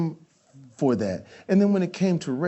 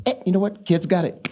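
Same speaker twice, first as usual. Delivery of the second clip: [name] impression; 4 semitones higher, faster than usual. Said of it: "He says".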